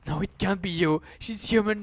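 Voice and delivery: usual speaking voice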